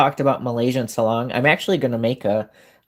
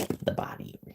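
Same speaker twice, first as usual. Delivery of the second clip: whispering; 14 dB quieter, much faster than usual. Real, not acted. Pointing out game body.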